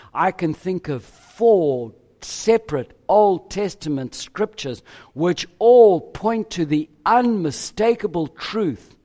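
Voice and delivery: ordinary delivery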